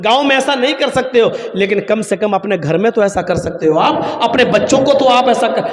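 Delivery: normal talking voice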